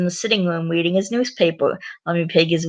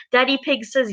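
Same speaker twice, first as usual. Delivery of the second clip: plain reading voice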